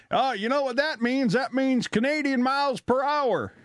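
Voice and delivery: high-pitched mock realization; lightly voiced tourist. American visitor puzzling out a Canadian road sign, mock realization. Acting out confused border crossers.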